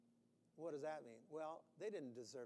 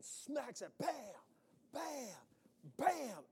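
acted strikes; 8 semitones higher, 6 dB louder, much slower than usual. Striking the rock, bam bam.

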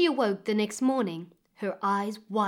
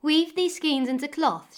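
a higher, louder royal command; queen's order; 5 semitones higher, 4 dB louder than usual. Acted. Queen commanding May to weave, higher and louder.

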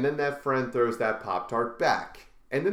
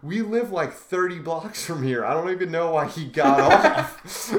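put-on laughing kid; 4 semitones higher, 6 dB louder than usual. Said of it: "he was like".

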